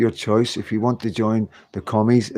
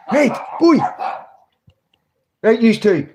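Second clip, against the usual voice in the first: calling to the dogs; 12 semitones higher, 3 dB louder than usual. Real, not acted.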